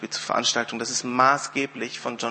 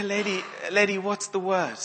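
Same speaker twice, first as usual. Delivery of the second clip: higher, awkward mild prodding; uneasy urging; 4 semitones higher than usual. Retold or imitated. Wimber to the weeping woman, uneasy urging. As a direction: prod a crying stranger, embarrassed.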